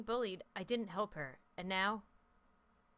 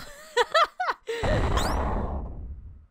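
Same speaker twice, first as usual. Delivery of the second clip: loud, high helpless laugh; burst of high laughter; 11 dB louder than usual. Real, not acted. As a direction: crack up at how awful it is. Laughing at the scene.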